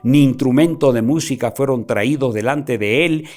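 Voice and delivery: loud, declaiming Bible reading; preaching scripture. Acted. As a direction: proclaim the verses to the congregation. Preacher reads scripture aloud.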